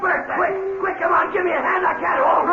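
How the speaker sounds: urgent call for help. Acted. Mac struggling to hold Frank.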